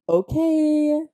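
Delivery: high, loud, drawn-out okay; exaggerated doubting reaction; sceptical okay. Real, not acted. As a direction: humour him with a stretched high "okay". Sceptical reaction to boastful quote.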